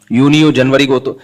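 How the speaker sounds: ordinary talk, nothing marked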